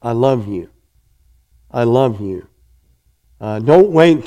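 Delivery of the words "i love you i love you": soft, tender I love you said to a loved one; quiet sincere declaration of love. Shown as a demonstration. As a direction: say it gently, like you mean it. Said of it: "We need to tell our loved one".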